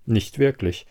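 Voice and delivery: ordinary delivery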